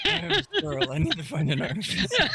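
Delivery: laughing, high and giddy. Real, not acted. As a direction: laugh through it with glee.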